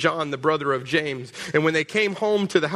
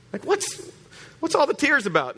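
high, baffled question; incredulous; 9 semitones higher than usual. Retold or imitated. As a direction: ask in high disbelief. Incredulous paraphrase.

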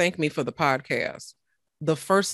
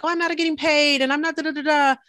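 higher, fast mocking whine; mock whining; 10 semitones higher, faster than usual. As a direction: parody your own petty complaints. Mocking her own old complaints.

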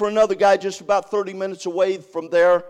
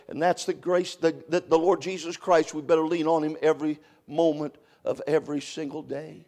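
usual speaking voice

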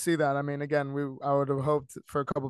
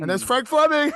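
mock broadcaster call; 10 semitones higher, 8 dB louder than usual.

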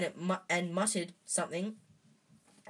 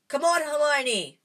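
louder, higher shout of Come on, Hermione!; loud call; 9 semitones higher, 10 dB louder than usual. A narrator's reading. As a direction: yell up for Hermione to jump. Urging friend to jump.

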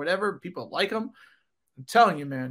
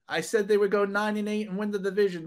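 normal talking voice

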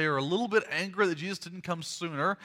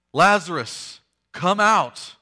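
louder, commanding call; 2 semitones higher, 9 dB louder, much slower than usual. Acted. Jesus summons the dead man from the tomb, calling loudly. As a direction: project the summons in two bursts. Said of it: "Jesus says in a loud voice".

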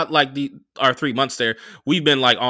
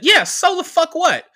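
high-pitched, louder mock retort; defiant retort; 5 dB louder than usual. Girlfriend hitting back at her boyfriend in a quarrel, high defiant retort.

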